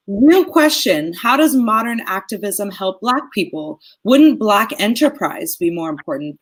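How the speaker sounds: normal talking voice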